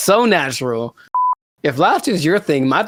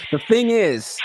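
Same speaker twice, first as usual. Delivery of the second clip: deliberate, higher-pitched restart without stumbling; a redone, fluent lead-in; cleaner retake; 5 semitones higher, slower than usual. Shown as a demonstration. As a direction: deliver "The thing is" cleanly, pitched up. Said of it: "I should have practiced".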